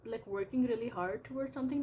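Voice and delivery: usual speaking voice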